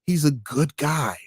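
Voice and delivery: a hint of a sarcastic fan; mock Biden supporter. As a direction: parody a Biden fan, dragged out. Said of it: "I like Joe Biden".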